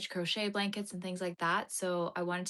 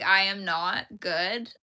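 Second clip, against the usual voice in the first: normal talking voice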